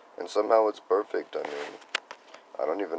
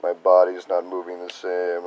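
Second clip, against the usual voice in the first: mock fretting; 3 semitones higher, 10 dB louder, much slower than usual. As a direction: whine it like a tired complaint. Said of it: "I'm like".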